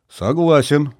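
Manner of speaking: decisive. A narrator's reading. Colonel agreeing to the plan, decisive. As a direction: agree with resolve. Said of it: "решительно кивнул полковник".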